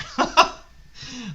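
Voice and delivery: high, loud surprised laugh; real burst of laughter. Real, not acted. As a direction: laugh out in disbelief. Reacting to news of five more lawns.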